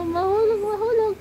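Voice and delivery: high, urgent cry of warning; alarmed warning. Real, not acted. Child about to fall. (natatarantang babala)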